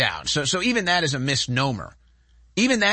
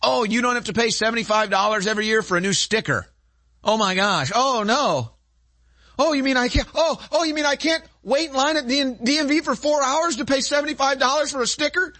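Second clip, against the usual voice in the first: sarcastic; 9 semitones higher than usual. Pretend. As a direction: ham up fake panic over the DMV. Sarcastic mock outrage.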